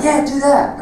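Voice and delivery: high, excited approval. Retold or imitated. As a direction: cheer it on enthusiastically. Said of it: "And he's like".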